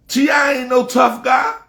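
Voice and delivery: high, loud angry call-out; furious shout at a rapper. Real, not acted. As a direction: shout him down. Heated rant calling out rapper.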